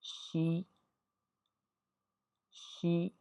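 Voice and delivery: शी with a soft thin sh and long ee; Chinese xi. Shown as a demonstration. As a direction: say शी with the tongue flat behind the teeth. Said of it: "जब मिलाएंगे ई से".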